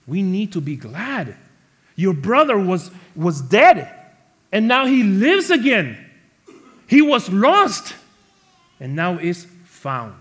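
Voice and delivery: high, loud pleading proclamation; impassioned father. Retold or imitated. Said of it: "His father tells him".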